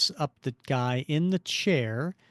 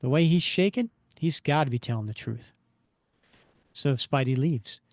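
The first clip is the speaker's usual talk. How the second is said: ordinary delivery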